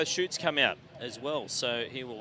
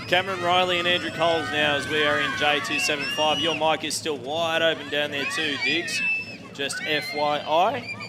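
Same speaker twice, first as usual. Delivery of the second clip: PA announcer call; 4 dB louder than usual. Acted. Announcer calls cars at show.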